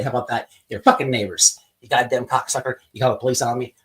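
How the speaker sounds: usual speaking voice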